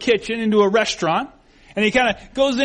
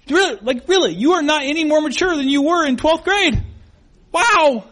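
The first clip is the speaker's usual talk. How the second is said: high-pitched, emphatic mock disbelief; backhanded amazement; 8 semitones higher, 4 dB louder than usual. Judging an old classmate's immaturity, incredulous. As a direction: let the put-down build to a loud wow. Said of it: "it really wasn't a compliment".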